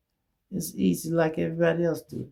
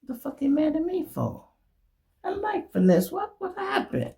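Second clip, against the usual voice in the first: much higher, puzzled voice; confused friend; 13 semitones higher, 3 dB quieter than usual. Retold or imitated. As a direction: act baffled and hurt. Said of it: "And he said".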